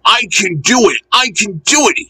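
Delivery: high, effortful engine chant; puffing. A narrator's reading. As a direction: puff it out in two eager bursts. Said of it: "he puffed".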